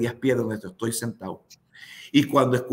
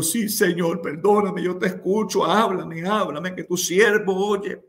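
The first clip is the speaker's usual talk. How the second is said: higher-pitched, urgent plea, retold; fervent pleading; 5 semitones higher, 3 dB louder, slower than usual. Servant answers the Lord's call, retold fervent pleading. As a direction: act out begging God to speak, pitched up.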